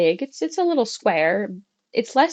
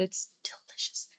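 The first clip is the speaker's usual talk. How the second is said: whispered, savouring the word; soft whisper of pleasure; whispered delight; 14 dB quieter than usual. Real, not acted. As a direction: breathe it out like a secret treat. Savouring the tea.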